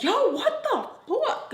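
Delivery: very high, drawn-out exasperated outburst; genuinely annoyed friend. Retold or imitated. Friend reacting as she walks by, truly annoyed. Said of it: "so annoyed".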